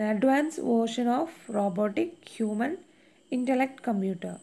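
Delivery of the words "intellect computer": usual speaking voice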